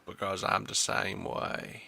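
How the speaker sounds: slow, breathy whisper of a menacing line; slow creepy whisper; creepy whispered quote. Retold or imitated. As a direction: hiss it slowly like a creep confiding. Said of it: "He's like".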